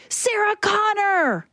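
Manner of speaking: high, slow drawn-out call across the street; retold yell. Stranger calling her across the street, slow high yell. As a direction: call out the name long and high. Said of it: "He's like".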